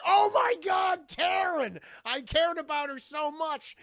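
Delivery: high, loud, hammed-up lament; mock anguished cry. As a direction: wail it out in acted grief. Jean reacts to friend's death.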